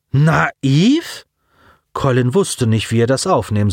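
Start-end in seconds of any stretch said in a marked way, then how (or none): 0.1-1.2 high, rising question; taken aback, pitch sliding up; incredulous echo. A narrator's reading. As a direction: query NAIV in disbelief. Echoes the alien's insult.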